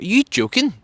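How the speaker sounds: high disbelieving voice. Retold or imitated. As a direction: ask it high and unbelieving. David's disbelief retold.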